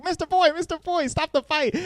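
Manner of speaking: squeaky high imitation of alarmed kids; hammed-up high-pitched alarm; mock panicked cries. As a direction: plead in a very high falsetto. Voicing panicked students calling out.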